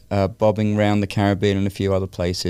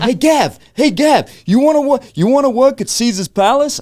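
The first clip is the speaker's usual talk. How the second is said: high, loud, hammed-up New Jersey accent; mock New Jersey guy offering a job, high and loud; 6 dB louder than usual. Friend Guido offers a job, in hammed-up New Jersey accent. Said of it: "It was a very heavy New Jersey accent".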